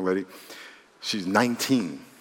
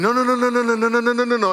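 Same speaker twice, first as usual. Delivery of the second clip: stubborn denial; 7 semitones higher, faster than usual. Retold or imitated. Woman brushing off her girlfriends' warnings, rapid denial. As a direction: shut the warnings down fast. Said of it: "girlfriends were like".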